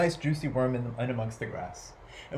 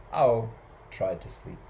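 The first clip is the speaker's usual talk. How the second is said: usual speaking voice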